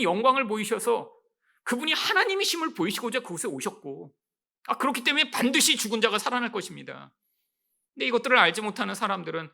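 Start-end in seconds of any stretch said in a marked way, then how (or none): none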